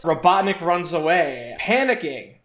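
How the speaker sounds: ordinary delivery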